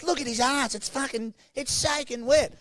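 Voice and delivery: high nagging voice scolding at the ice bath; mocking a bossy woman telling them to shower; mock shrill woman. Woman quoted.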